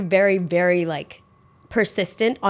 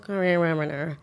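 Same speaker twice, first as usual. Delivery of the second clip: slurred mumble of CARBONARA; slurred, unintelligible imitation; 2 semitones lower, 4 dB quieter than usual. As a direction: imitate a dozy guest smearing CARBONARA together. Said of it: "I don't really understand what they're saying".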